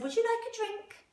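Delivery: mummy welcomes the tiger in a high, cheerful voice. Acted. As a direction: answer the door like a chirpy mum. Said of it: "Sophie's mummy said".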